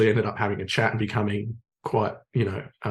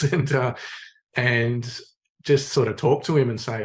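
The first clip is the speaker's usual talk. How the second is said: normal talking voice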